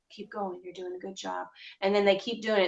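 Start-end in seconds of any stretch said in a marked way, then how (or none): 0.1-1.5 much softer, gentle; soft therapist encouragement. Shown as a demonstration. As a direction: quietly urge them on. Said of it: "I encourage them".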